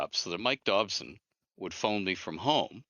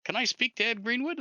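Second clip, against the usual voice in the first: much higher, lightly imitated American caller; caller's voice; 14 semitones higher than usual. Jeff on the phone asking for Ed, voiced much higher. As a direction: pitch it way up for the voice on the phone. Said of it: "this voice on the other end says".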